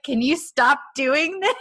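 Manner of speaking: mock exasperated man asking her to stop; exasperated plea. Man telling the woman to stop, mock exasperated.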